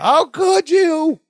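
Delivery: high, slow mock scream. Jennifer screaming over the phone, high drawn-out mock wail. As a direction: drag out a put-on shriek. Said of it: "You could hear her scream".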